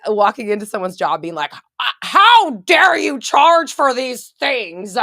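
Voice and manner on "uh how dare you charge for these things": loud, high, slow hammed-up indignation; mock outrage. Imagined customer confronting someone at work, in hammed-up outrage. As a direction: draw out every word as an appalled customer.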